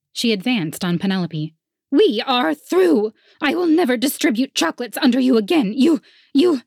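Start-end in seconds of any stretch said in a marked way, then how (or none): 1.9-6.6 furious chocolatier. A narrator's reading. Dee Dee confronts Penelope.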